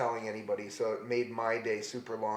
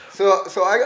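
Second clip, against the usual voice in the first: ordinary talk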